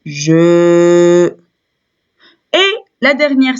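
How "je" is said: "je" held long on one note; drawn-out syllable. Shown as a demonstration. Teacher giving the sound of J E U, "je" held long. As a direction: stretch the vowel of "je" evenly. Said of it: "ça nous donne je".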